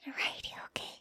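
soft, breathy, unvoiced whisper; giving in to the moment. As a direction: breathe the words close to the mic.

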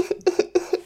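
high, hammed-up boo-hoo noises; mock sobbing. Narrator imitating Cinderella, face in her hands, mock sobbing. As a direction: fake little sobs in short bursts. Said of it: "being like".

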